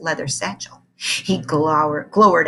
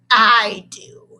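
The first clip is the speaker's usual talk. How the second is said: snarling; 3 semitones higher, 7 dB louder than usual. Acted. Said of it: "snarled Mister Kinderschmacher".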